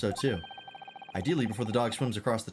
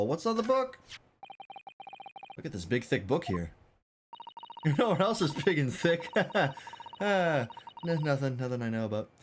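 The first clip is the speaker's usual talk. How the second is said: usual speaking voice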